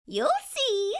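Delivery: playful magical fairy teasing gently. Acted. Fairy coaxing Jessie to look, high playful voice. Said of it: "said the fairy".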